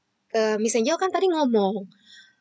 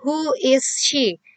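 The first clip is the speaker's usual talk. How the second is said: each word slow and clear, a touch higher; a teacher modelling a question for children; slow model reading; 4 semitones higher, much slower than usual. Shown as a demonstration. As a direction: read it out slowly for the class. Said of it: "harusnya ada di sini kan".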